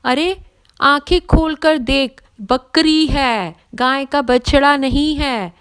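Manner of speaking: high, loud and slowed, hammering the words home; angry outburst. Acted. Pandit angrily shouting at the second thug. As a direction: tell him to open his eyes, furious. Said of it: "पंडित को सुनकर फिर गुस्सा आया".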